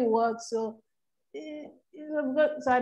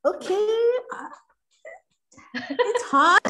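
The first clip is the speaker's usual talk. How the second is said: high laughing exclamation; 13 semitones higher, 5 dB louder, slower than usual. Real, not acted. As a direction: burst out with a laugh, much higher. Asked a hard question, laughs.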